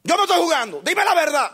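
high, loud impatient king; angry demand. Retold or imitated. King Acab demanding the truth from the prophet, angrily. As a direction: snap at him to stop playing.

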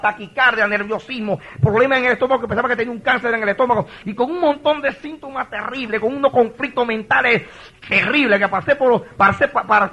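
high-pitched, urgent testimony; impassioned preaching. Real, not acted. Pastor telling the congregation his terrible symptoms, impassioned preaching.

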